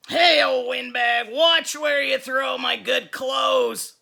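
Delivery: loud, slow snarl instead of a hiss; nasty monster boy. Acted. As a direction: sneer it at the teacher. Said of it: "Sammy hissed".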